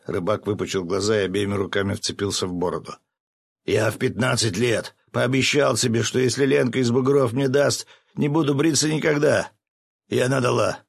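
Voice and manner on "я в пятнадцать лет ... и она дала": high, loud, insistent plea; impassioned protest. A narrator's reading. Fisherman defending his beard to friends, impassioned protest. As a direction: defend it heatedly, pitched up.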